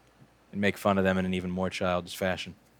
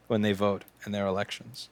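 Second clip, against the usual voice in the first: ordinary talk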